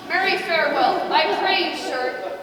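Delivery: huffy, loud and high; indignant. Acted. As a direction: bristle with offence. Nurse offended by Mercutio.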